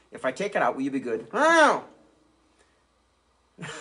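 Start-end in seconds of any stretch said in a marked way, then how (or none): 1.3-3.7 high squeaky puppet pleading no; shrill little puppet begging. Acted. Puppet dog pleading with ventriloquist, squeaky high protest.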